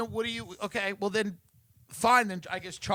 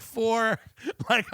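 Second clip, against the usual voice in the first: ordinary talk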